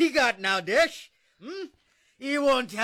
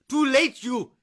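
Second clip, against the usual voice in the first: louder and higher, spat out; angry taunt; 4 semitones higher, 5 dB louder than usual. Acted. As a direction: throw it in her face. Drunk husband mocks ex-wife.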